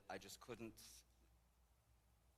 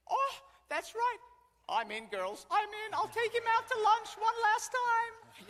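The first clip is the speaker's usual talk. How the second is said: very high, loud, drawn-out cry; gleeful realization; 18 dB louder, slower than usual. Retold or imitated. Widow joyfully agreeing to bring husband to lunch, gleeful. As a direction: imitate a thrilled old lady announcing it.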